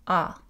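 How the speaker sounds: a dark back A, Québec style; A rounded toward o. Shown as a demonstration. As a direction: say A far back in the mouth. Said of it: "le A est postérieur".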